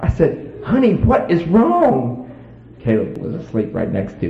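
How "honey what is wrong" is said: concerned. Retold or imitated. Husband asks his sobbing wife.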